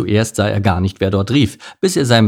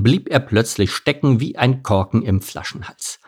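usual speaking voice

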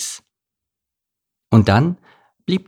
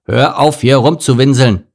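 loud, irritated outburst, pitch raised; angry interruption; 4 semitones higher, 7 dB louder than usual. A narrator's reading. Camel cuts off the whining donkey, angry and loud. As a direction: cut in angrily and loudly. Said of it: "unterbrach ihn Konrad wütend".

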